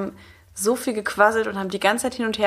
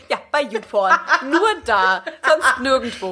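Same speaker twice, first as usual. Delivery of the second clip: laughing; 4 semitones higher than usual. Real, not acted. Joking about YouPorn career.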